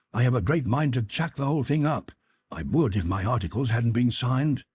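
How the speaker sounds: lightly voiced youth, stubborn; a touch of a brooding sixteen-year-old; Jim, sulky lad. Brother grumbling to his sister about mother, stubbornly.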